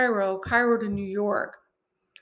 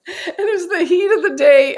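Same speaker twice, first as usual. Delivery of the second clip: loud, high, through laughter; amused outburst, pitched up; laughing; 8 semitones higher, 9 dB louder than usual. Real, not acted. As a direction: laugh your way through the line. Laughs telling comic rescue scene.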